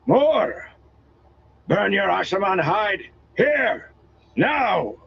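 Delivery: high, bellowing call; shouting. A narrator's reading. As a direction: bellow over the battle din. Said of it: "raising his voice above the din".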